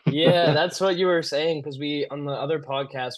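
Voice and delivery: ordinary talk, nothing marked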